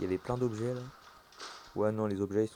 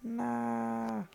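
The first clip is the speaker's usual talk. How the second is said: scared horror game streamer; 12 semitones higher, much slower than usual. Real, not acted.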